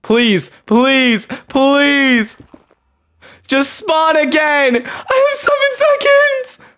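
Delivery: high, stretched-out desperate wail; desperate pleading. Real, not acted. Gamer begging for a spawn with seconds left, desperate pleading.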